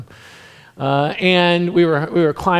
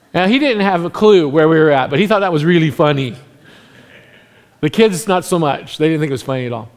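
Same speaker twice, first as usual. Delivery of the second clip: usual speaking voice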